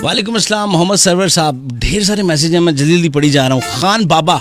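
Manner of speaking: normal talking voice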